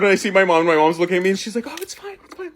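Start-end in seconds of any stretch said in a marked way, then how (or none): 1.7-2.5 very soft, high mouthed aside; barely audible, high mock reassurance; hushed reassurance. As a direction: imitate a mom quietly reassuring from the audience. Mom reassuring her from the crowd.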